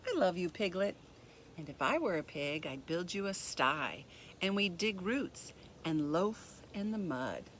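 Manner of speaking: gentle, even storybook reading; reading to children. A narrator's reading. Teacher reading a picture book to kids, gentle storybook voice.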